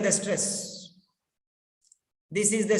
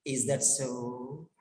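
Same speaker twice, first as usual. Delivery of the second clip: "Is that so?" very soft and low, tune falling; falling tone demo; 9 semitones lower, 10 dB quieter than usual. Shown as a demonstration. Teacher shows students a falling tone on "Is that so?". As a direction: let the question drop away gently. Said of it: "Falling tone".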